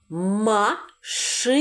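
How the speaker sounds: stretched syllable by syllable; slow teacher's model word; slow sounding out. Shown as a demonstration. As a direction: drag the word out for the class. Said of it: "Вимовляємо це слово".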